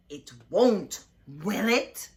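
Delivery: loud, slow, ominous teacher threat; a strict teacher warning the class; menacing warning. A narrator's reading. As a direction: make them feel watched. Miss Swamp threatening the class.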